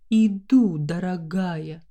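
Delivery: ordinary delivery, nothing marked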